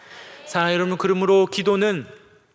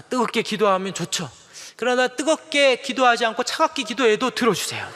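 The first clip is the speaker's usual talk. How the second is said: ordinary talk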